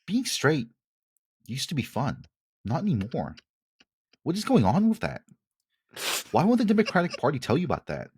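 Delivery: high, incredulous news-anchor voice; Tucker Carlson impression. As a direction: play a baffled cable host. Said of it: "a small homage to our good friend Tucker".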